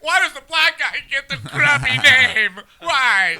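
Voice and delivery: high, loud comic protest; mock outrage. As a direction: wail the complaint in mock indignation. Comic outrage over character's name.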